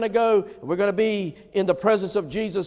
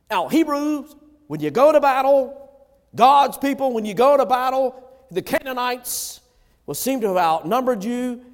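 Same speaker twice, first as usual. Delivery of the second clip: high, loud rallying call to God's people; rally the people for battle; preaching at full force; 4 semitones higher, 5 dB louder than usual. Acted. Preaching to the Hebrews.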